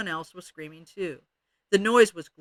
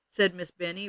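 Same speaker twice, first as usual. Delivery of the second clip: ordinary delivery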